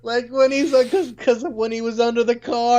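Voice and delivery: high, choked-up voice, half laughing; getting emotional. Real, not acted. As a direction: voice climbs high as feelings well up. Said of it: "I'm getting emotional".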